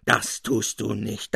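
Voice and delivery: usual speaking voice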